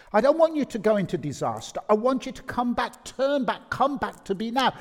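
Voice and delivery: high, insistent appeal to come back; urgent pleading. Acted. God pleads with the people.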